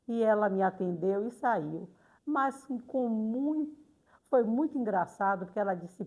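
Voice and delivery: ordinary delivery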